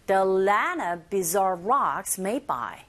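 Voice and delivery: slow model with buzzing z in "bizarre"; teacher slowly models the phrase; slow drill line. Shown as a demonstration. Teacher drills the phrase slowly for students to repeat. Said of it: "listening three".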